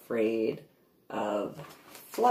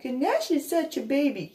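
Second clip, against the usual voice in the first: put-on kids teasing; 11 semitones higher than usual. Kids mocking dog.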